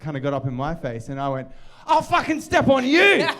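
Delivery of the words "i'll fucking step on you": loud, high-pitched re-enacted threat; hammed-up aggressive yell; aggressive retort. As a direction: blurt out an over-the-top comeback. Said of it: "I've never heard you that aggressive ever".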